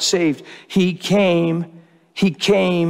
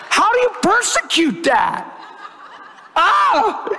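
laughing cry of joy; 13 semitones higher, slower than usual. Real, not acted. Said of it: "See, I'm a mess".

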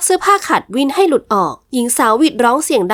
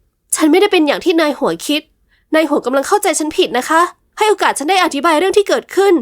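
higher, pleading woman's voice; frightened young woman pleading; 5 semitones higher than usual. Acted. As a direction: beg him to hear her out. Heroine pleads with the master. (หญิงสาวอ้อนวอนนายหัวด้วยเสียงสูงหวาดกลัว)

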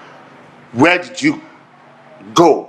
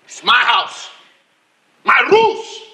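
loud, punched short phrases; angry demand; 3 semitones lower, 5 dB louder than usual. Acted. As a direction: lay down the law, building to a shout. Husband demands answers in quarrel.

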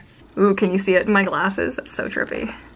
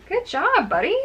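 much higher praise for the dog; 10 semitones higher than usual. Real, not acted. Owner praising her calm dog, high happy praise. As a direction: lift it up high to reward the dog.

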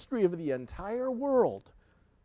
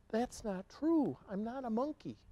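hushed, higher-pitched denial; soft protest; 6 semitones higher, 11 dB quieter than usual. Acted. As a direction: protest under your breath. Reader protests in dismay.

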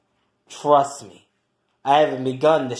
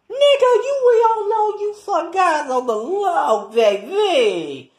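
much higher, cracking sassy taunt; high squealing mockery with a teasing laugh; 12 semitones higher than usual. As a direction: tease him in a shrill mock whine. Mocking roommate in the argument.

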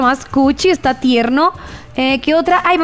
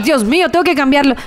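normal talking voice

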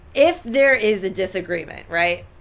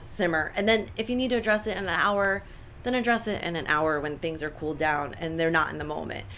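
ordinary talk, nothing marked

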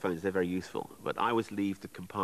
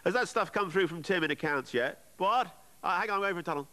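high, raised office-chat phone voice; loud mobile caller; 12 semitones higher, 4 dB louder than usual. Retold or imitated. Train passenger checking on accounts by mobile, high and loud. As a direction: mimic a passenger talking over a bad line. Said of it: "all saying, saying".

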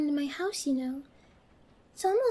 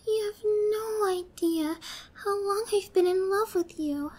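ordinary delivery

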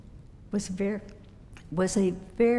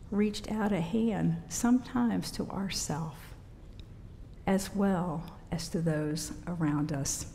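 ordinary talk, nothing marked

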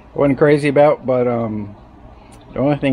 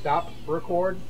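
voice command; 7 semitones higher, 7 dB quieter, slower than usual. Shown as a demonstration. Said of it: "and listen closely".

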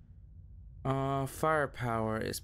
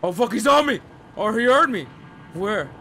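high, loud panicked yelling; panicking gamer; 8 semitones higher, 12 dB louder than usual. Real, not acted. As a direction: shout in sudden panic. Enemy suddenly attacks him.

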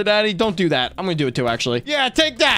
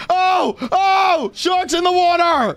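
panicked shout; 8 semitones higher, slower than usual. Real, not acted. Shark attack in game.

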